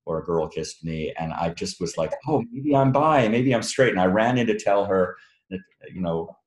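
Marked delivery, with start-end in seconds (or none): 2.3-3.9 higher-pitched, mock-excited self-quote; excited realization. Author retelling his youthful realization after a kiss, mock excited. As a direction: act out a sudden hopeful discovery.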